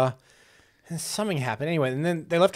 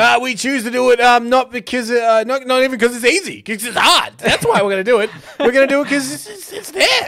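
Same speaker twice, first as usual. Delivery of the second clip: loud, high speechmaker making it up as he goes; hammed-up stump speech, groping for reasons; JFK parody; 9 semitones higher, 7 dB louder than usual. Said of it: "J F K's famous speech".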